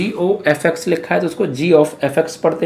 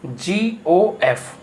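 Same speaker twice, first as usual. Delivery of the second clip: slow naming; much slower than usual. Shown as a demonstration. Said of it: "वो क्या बनेगा".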